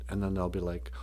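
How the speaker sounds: ordinary delivery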